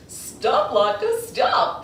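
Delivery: high yelled stop; shouted. Acted. Two boys shouting at the rolling latkes. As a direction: holler at them to halt. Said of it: "they shouted".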